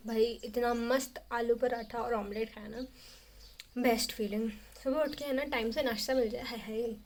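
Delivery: ordinary talk, nothing marked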